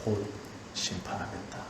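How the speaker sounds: hushed judgment. Acted. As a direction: speak the verdict in a grave hush. God's one word of judgment.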